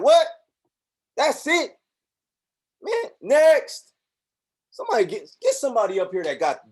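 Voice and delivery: high, loud dismissive God voice; God unimpressed. Acted. God dismissing a vague prayer, high and loud. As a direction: wave them off in disbelief. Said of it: "God is like".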